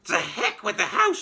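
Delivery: put-on high, loud screechy old woman; greedy old grandma yelling down; yelling crone. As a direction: demand it in a shriek. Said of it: "she shouted".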